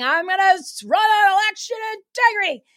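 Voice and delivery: high, loud mock campaign slogan; exaggerated, high-pitched politician imitation; mocking quote. Mockingly quoting a politician's campaign claim.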